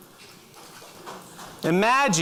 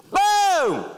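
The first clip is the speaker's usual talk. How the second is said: loud, high-pitched shouted "boom"; imitated explosion; 12 semitones higher, 4 dB louder than usual. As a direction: explode on one drawn-out word. Imitating grenade going off.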